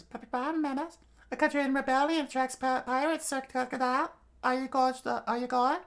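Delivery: very high-pitched southern woman's voice; high southern falsetto; southern accent. Acted. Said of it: "I gave her the southern accent".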